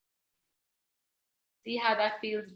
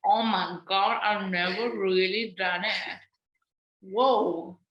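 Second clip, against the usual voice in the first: talking with face scrunched; 5 dB louder than usual. Pretend. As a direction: speak while pulling a funny face. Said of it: "Maybe I'm just gonna talk like that".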